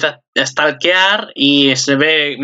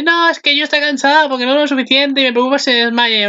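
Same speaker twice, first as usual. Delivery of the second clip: innocent excuse; 11 semitones higher, faster than usual. Retold or imitated.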